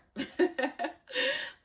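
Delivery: loud, high burst of laughter; a real high laugh at herself; big laugh. Real, not acted. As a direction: laugh it off loudly. Laughs after the outburst.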